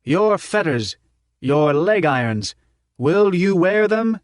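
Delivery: high, slow rallying speech; old professor rousing a packed dome; rousing orator. A narrator's reading. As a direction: stir the crowd, question by question. Prof rousing the crowd.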